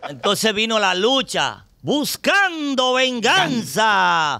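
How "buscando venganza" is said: slow, higher, drawn-out promo announcer; fight announcer. Retold or imitated. Teller imitating the TV promo announcing Sunday's fight, drawn out and higher. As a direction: announce the big match like TV hype.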